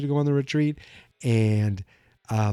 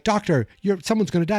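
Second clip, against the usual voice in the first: panicked warning; 7 semitones higher than usual. Retold or imitated. Friends warn doctor of death.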